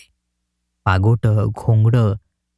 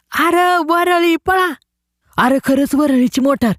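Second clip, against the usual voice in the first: much higher, drawn-out excited cry; excited shout; 12 semitones higher, slower than usual. A narrator's reading. A boy shouts to his friends that the Worli bus is here, very high and slow. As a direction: call the others to run for the bus.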